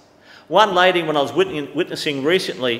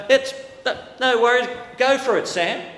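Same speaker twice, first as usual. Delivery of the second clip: mock casual; 8 semitones higher than usual. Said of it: "Would I stay up and go".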